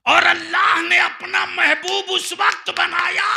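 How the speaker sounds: high, loud oratorical shout; full-force preaching. Real, not acted. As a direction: challenge the crowd at the top of your voice. Preacher proclaims climax.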